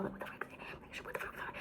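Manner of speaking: hushed, exasperated whisper; whispered frustration. Real, not acted. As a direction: mutter the curse under your breath in a whisper. Self-scolding whispered aside.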